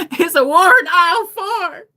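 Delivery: high, loud, laughing through the words. Real, not acted. Storyteller cracking up over aisle four, laughing loudly. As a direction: crack up while announcing it.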